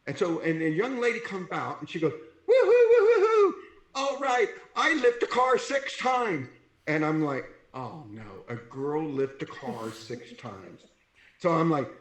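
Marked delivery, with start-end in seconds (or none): 2.5-6.5 high, loud crowing imitation of a cheering competitor; triumphant mock whoop. Imitating a triumphant young woman competitor. 7.7-10.8 low, slow, soft dismayed echo; mock dismay. His dismayed reply to her boast.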